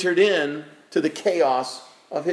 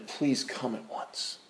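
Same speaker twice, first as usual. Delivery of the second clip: urgent whispered plea; 4 semitones lower, 12 dB quieter, slower than usual. Retold or imitated. Messengers urging Peter to come.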